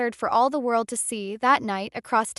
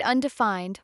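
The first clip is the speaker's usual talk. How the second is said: ordinary delivery, nothing marked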